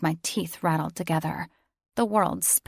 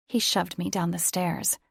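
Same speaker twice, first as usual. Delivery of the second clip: ordinary delivery, nothing marked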